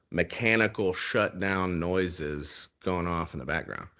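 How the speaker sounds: ordinary delivery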